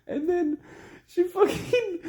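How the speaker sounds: very high, laughter-choked words; cracking up. Real, not acted. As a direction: lose it while trying to carry on. Breaks up laughing mid-story.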